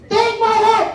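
high, slow hammed-up shout; shouted offer. A subject volunteering his heart, high shout. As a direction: yell it out heroically. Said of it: "pag sabi".

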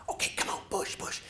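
hushed whisper urging push; conspiratorial whisper. Retold or imitated. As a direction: whisper so the cat won't wake. Sneaking the car out quietly.

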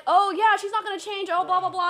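mocking the doubters. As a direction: parrot the naysayers mockingly. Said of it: "people are like".

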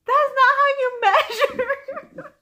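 high, loud protest through laughter; laughing objection. Retold or imitated. Correcting a friend's knitting while laughing, high and loud.

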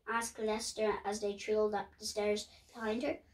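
ordinary delivery, nothing marked

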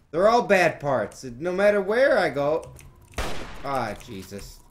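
high, very loud angry yelling; raging yell. Real, not acted. Gamer raging that every path is bad, furious yelling. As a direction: rail at the game at full volume.

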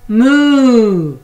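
loud, high drawn-out moo; cow moo. Acted. The cow calls to the busy spider, loud mooing. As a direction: bellow it like a cow. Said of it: "said the cow".

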